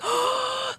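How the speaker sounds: sharp high gasp of surprise. Retold or imitated. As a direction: gasp in mock astonishment. Nurses stunned to see them.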